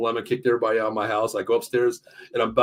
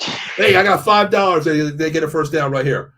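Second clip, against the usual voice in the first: louder, higher hyped-up betting call; mock excited bettor; 5 semitones higher, 4 dB louder, slower than usual. Teasing his cohost about the broadcast delay: hyped-up mock betting. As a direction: play the friend who already knows the outcome.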